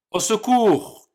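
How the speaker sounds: high panicked shout; scared cry for help. Acted. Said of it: "cria le premier petit cochon".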